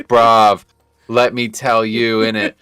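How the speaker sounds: slow London roadman voice, bruv and innit; British impression. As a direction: drawl it like a London lad. Imitating British friend Harry.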